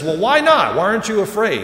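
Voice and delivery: exasperated, shouted comic outrage; really mad devil. Acted. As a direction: play the devil losing his temper, loud and high. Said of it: "now the devil's really mad".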